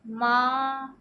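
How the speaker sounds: माँ held long and voiced through the nose; teacher's nasal demo; drawn-out nasal maa. Shown as a demonstration. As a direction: stretch the nasal vowel in माँ. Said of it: "माँ बोल रहे हैं तो नाक से भी वायु".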